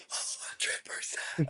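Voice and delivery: slow, emphatic voiceless whisper; whispered confirmation. Retold or imitated. Friend confirming to the twin, emphatic whisper. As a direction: whisper it with drawn-out certainty. Imitates confirming reply across the room.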